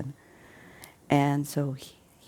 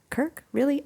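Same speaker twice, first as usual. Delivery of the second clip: mocking disbelief; 11 semitones higher than usual. Wife mocks the plane's name.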